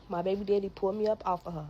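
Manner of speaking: usual speaking voice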